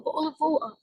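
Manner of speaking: British "bottle of water" with dropped t's; British glottal accent. Shown as a demonstration. Teacher showing another British variant, glottal-stop British accent. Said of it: "إذا بدنا نروح على البريتش".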